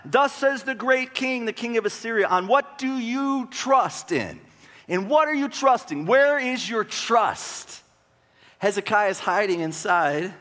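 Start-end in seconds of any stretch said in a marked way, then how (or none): 0.1-7.8 slow, high-pitched taunting proclamation; taunting messenger. Acted. Messenger on the wall trash talking Jerusalem, high drawn-out taunt. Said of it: "he's just trash talking".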